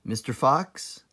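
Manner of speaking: ordinary delivery, nothing marked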